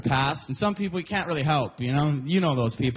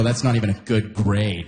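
lower-pitched put-on reproach; mock disapproval; 8 semitones lower than usual. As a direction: imitate a friend who is not impressed. Friends repeat their reproach.

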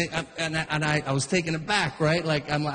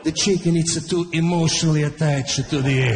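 lightly voiced Italian dad; a hint of Italian accent, lecturing; 3 semitones lower than usual. Dad lecturing young Frank in a light Italian accent. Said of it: "It's like".

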